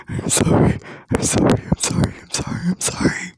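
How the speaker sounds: broken whispered apologies; whispered sorry. A narrator's reading. Deku whispering apologies after breaking down, hushed. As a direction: fade to a breathless whisper. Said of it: "I'm so sorry".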